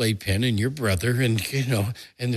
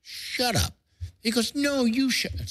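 1 mock shouting match. Quarrel over towels.

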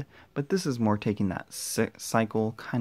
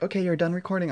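mock camera voice; 8 semitones higher, 5 dB louder, faster than usual. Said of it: "my camera's all like".

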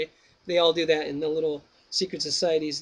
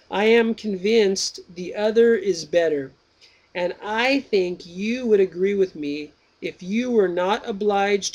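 slow, higher, deliberate reading voice; reading Plato's Meno aloud, slow and raised; slow dialogue reading; 4 semitones higher, 4 dB louder, slower than usual. Acted. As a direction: read the old text out slowly and carefully.

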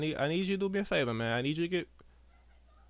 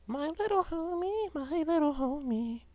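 high, slow sing-song call; much higher, slow, playful calling voice; cutesy high call; 14 semitones higher, slower than usual. Pretend. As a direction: coo for a little buddy. Calling his game buddy.

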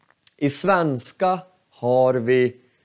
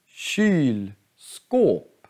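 Kylskåp in two long stressed halves; Kylskåp slowly, equal weight on each part; two stressed syllables. Shown as a demonstration. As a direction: draw out both Kyl and skåp, pausing between. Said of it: "Det är två betonade stavelser".

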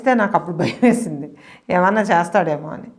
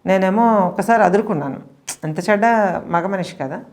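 ordinary talk

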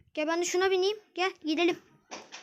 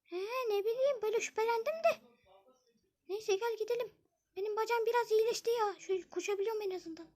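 put-on girl voice; 5 semitones higher, 5 dB quieter than usual. Zeynep admits her suspicion.

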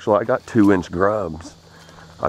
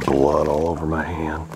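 ordinary talk, nothing marked

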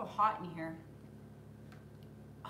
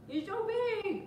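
doggy talk; 12 semitones higher than usual. Real, not acted. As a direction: squeak it up to the puppy. Cooing at the dog.

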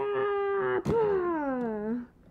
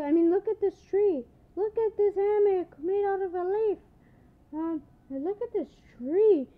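higher, quick chirpy Charmander; 7 semitones higher, 4 dB louder than usual. Pretend. Charmander toy urges friends to clean up, higher. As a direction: rattle off the plan brightly.

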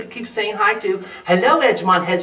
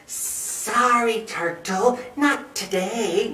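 sleepy murmuring snake; 4 semitones higher, much slower than usual. A narrator's reading. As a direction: stretch the words out like a drowsy snake. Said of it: "murmured Snake".

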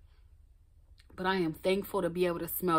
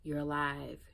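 self-soothing; 3 semitones lower, 8 dB quieter, slower than usual. Retold or imitated. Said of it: "pulling myself in, like".

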